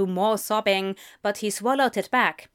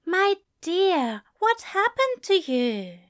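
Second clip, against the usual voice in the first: higher, gentle worried angel voice; 9 semitones higher, slower than usual. A narrator's reading. Angel comforts the sobbing demon.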